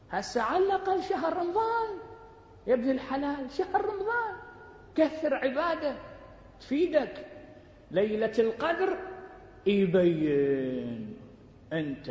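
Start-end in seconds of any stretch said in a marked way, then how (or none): 0.1-9.0 high, urging preacher's call; impassioned preaching. Acted. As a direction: plead with the faithful to strive. Preacher exhorts the congregation.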